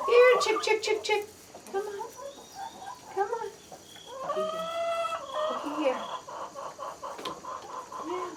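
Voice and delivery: high, soft sing-song chicken call. Real, not acted. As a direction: coax the hens over in a high voice. Calling chickens to feed.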